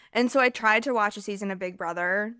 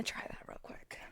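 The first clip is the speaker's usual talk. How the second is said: voiceless whisper, said aside to herself; real whisper; 17 dB quieter than usual. Real, not acted. Whispering to viewers while stepping away to open the window. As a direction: breathe it out as a quick aside.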